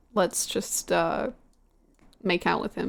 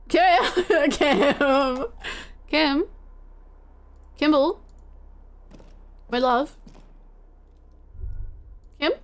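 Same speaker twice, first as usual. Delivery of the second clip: calling out; 5 semitones higher, much slower than usual. Real, not acted. Calling for missing companion.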